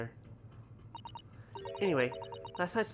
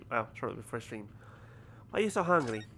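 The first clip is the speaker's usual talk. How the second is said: ordinary talk, nothing marked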